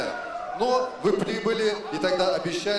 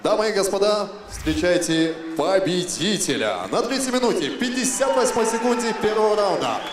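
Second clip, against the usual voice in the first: ringmaster; 3 semitones higher, 5 dB louder than usual. Acted. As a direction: stretch the winner's name for the crowd. Ring announcer introduces winner.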